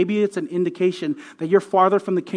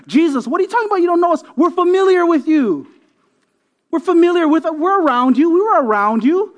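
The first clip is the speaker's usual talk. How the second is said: high-pitched, louder insistence; acted incredulous protest; 9 semitones higher, 4 dB louder than usual. People insisting to Jesus they are familiar, incredulous protest. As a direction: plead your case, voice pitched right up.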